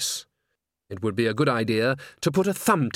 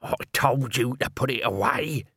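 hissed. A narrator's reading. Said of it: "Nobby hissed".

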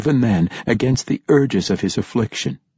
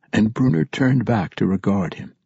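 ordinary talk

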